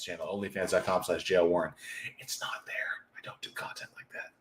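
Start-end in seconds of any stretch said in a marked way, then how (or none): 2.2-4.3 soft unvoiced whisper, barely audible; whispered aside. Real, not acted. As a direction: hush it like a secret joke. Joking aside about OnlyFans.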